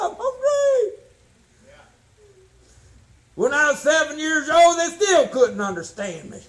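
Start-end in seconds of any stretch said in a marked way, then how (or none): 0.0-1.0 put-on high garbled baby voice with a laugh; baby voice babble. Preacher doing himself as a newborn, garbled baby voice. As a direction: play a newborn who can't talk yet. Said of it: "I came out".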